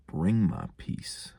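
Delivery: ordinary delivery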